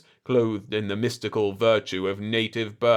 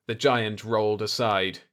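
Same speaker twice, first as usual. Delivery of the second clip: ordinary delivery, nothing marked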